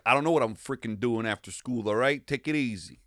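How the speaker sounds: put-on tough New York talk; New York accent. Imitating friend at school, casual mock New York accent. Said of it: "You're like".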